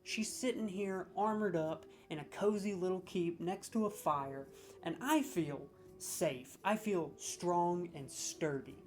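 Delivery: normal talking voice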